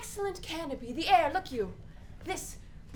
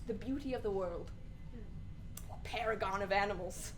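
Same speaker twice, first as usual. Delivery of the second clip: hushed reflective; 4 semitones lower, 6 dB quieter than usual. Acted. Hamlet's "piece of work" speech.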